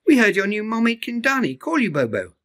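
put-on high, friendly baby elephant voice; the smallest calf saying hello; little Pika Pika.

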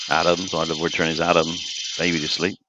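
ordinary delivery

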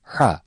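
H softly from a tightened mid-throat; Arabic ha demo. Shown as a demonstration. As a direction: breathe out H softly with the throat slightly squeezed. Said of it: "boğaz biraz sıkılarak yumuşak bir şekilde okunur".

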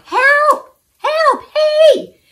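very high, loud yells; boy demonstrating how to yell for help. A narrator's reading. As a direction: shout to be heard from the road. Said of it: "just yell real loud".

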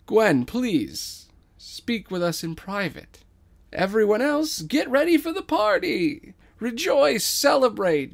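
high, loud rousing address; rally them like a grand showman; regal king. Acted. King calming and rallying his people, high loud proclamation.

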